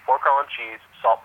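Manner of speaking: ordinary talk, nothing marked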